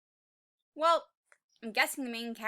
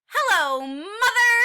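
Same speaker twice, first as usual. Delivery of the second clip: much louder, higher joyful hello with a laugh; excited greeting; 8 semitones higher, 14 dB louder than usual. Acted. Tiny T-Rex thrilled to find his mother. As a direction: burst out happy to see mom. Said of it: "how excited Tiny is".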